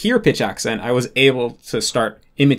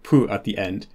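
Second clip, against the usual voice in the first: ordinary talk, nothing marked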